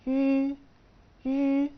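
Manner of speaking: يو said high, loud and clear, twice; teacher models يو; model syllable. Shown as a demonstration. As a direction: sound out يو slowly for students. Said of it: "هتتنطق".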